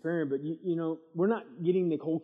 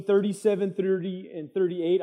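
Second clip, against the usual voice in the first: ordinary talk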